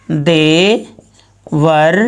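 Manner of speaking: very slow, each syllable stretched; teacher dictating the word slowly to students; drawn-out teaching demo. Shown as a demonstration. Teacher spells the word out slowly for the class. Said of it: "ये पूरा शब्द हो जाएगा".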